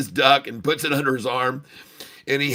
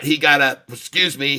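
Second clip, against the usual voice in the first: usual speaking voice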